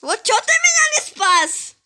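high loud wailing shout; panicking gamer. Real, not acted. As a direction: shriek at the teammate. Dying, blames teammate.

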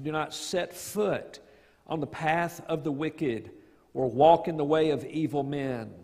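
normal talking voice

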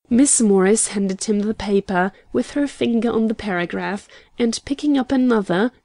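ordinary delivery, nothing marked

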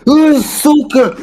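high, drawn-out swearing yell; mock angry outburst. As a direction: blurt it out like a mock rage. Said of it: "И ты такой".

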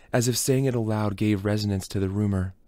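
normal talking voice

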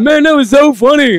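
high, loud mock enthusiasm; enthusiastic crowd member. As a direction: gush like a delighted audience member. Crowd's reaction retold.

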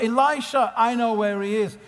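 high, innocent eager voice; wide eyed naive. Retold or imitated. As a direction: play dumb with big eyes. Elisha plays innocent.

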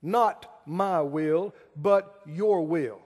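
slow, stress on your; slow quoted prayer. Acted. Preacher quoting Jesus' prayer to prove a point, slow and weighted. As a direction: lean on your, slowly. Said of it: "how can you say".

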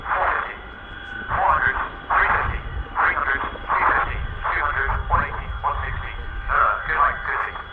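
crew intercom over rotor noise; clipped radio countdown calls. Real, not acted.

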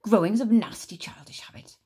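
a sneering hiss at the end; scornful grandma. Acted. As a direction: spit out childish.

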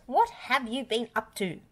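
high-pitched accusing question; scolding. A narrator's reading. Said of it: "yelled Farmer Pickles".

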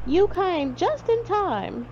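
high, slow exclamation of relief; exclaiming. A narrator's reading. Rescued hunter thanks Barnaby, high drawn-out exclamation. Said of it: "he exclaimed".

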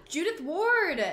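high, loud, drawn-out greeting; starstruck excitement. Real, not acted. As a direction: gasp and squeal the name. Gasping at celebrity's arrival.

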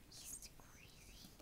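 secret whisper. Real, not acted. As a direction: whisper it aside. Child whispers aside.

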